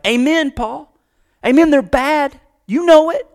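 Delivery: high, loud congregational approval, climbing in pitch; mock amen shouting. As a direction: cheer the preacher on, hammed-up. Congregation cheering Paul on, acted.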